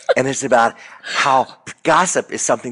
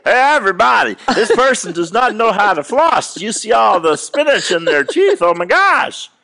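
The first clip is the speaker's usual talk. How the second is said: higher and louder, calling to everyone; loud public announcement; 11 semitones higher, 6 dB louder than usual. Retold or imitated. Announcing spinach in someone's teeth loudly to everybody. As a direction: broadcast it to the whole room. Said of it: "I don't have to say".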